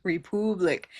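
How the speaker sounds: "republic" as "re-POO-blik"; blue-vowel mispronunciation. Shown as a demonstration. Teacher demonstrating blue to the learner, "republic" as re-POO-blik. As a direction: put a long "oo" in the middle of "republic". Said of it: "if it were blue, what would it be".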